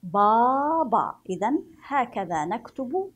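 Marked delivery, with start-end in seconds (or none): 0.0-1.1 بابا said slowly and loud with long aa; stretched baba. Shown as a demonstration. Teacher reading the word baba to children. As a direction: draw out بابا. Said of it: "لأن الباء التصق بآ".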